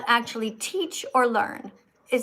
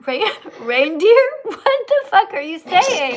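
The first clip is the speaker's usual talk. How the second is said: laughing in disbelief; 6 semitones higher, 6 dB louder, slower than usual. Real, not acted. Teacher baffled by the caller.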